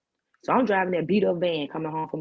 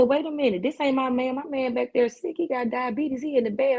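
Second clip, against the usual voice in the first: indignant correction; 7 semitones higher than usual. Retold or imitated. Setting the man straight.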